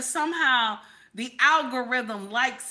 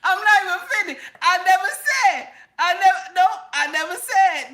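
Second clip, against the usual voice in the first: loud insistence; 8 semitones higher, 7 dB louder than usual. Real, not acted. Heated insistent denial.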